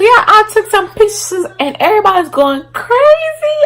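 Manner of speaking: high laughing shouts; laughing loudly. Real, not acted. Laughing shout over pictures.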